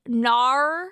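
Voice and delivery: drawn-out Australian "nar", a bit loud; Australian accent. Retold or imitated. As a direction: imitate a thick Australian "nar". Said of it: "Australian people saying, like".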